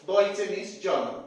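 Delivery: ordinary talk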